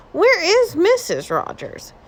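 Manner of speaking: high, slow, quizzical neighbor; a curious husband asking lightly; Mister Rogers voice. A narrator's reading. Mister Rogers asking after his wife, high and curious.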